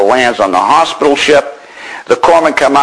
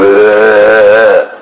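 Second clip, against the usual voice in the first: a louder retold groan of pain; a parched man's groan, louder; 4 dB louder than usual. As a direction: groan it like you can't get words out. Parched, just woken, answering corpsman.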